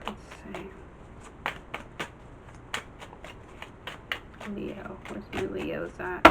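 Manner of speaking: soft whispered muttering; whispering to herself. Real, not acted. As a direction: murmur it while searching. Muttering while searching cards.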